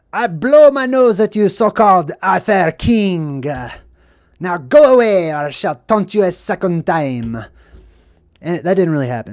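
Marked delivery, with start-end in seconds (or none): 0.1-7.4 hammed-up taunting Frenchman; mocking French guard jeering down at the king; Monty Python French taunt. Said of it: "It's from Monty Python".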